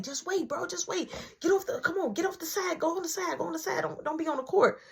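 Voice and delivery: high, hurried, brushing-off voice; acting out a preoccupied friend; retold dismissive friend. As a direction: shoo someone off the court. Retold friend shooing him aside.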